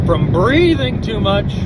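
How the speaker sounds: ordinary talk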